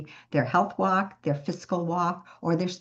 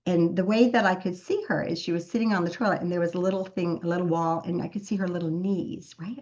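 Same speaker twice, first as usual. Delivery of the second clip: ordinary talk, nothing marked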